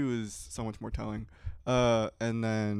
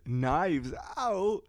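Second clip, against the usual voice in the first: slower, punchier, emphatic delivery; more energetic; 7 semitones higher, 4 dB louder than usual. Shown as a demonstration. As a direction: sell the title with extra energy. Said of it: "for those of you who think I lack energy".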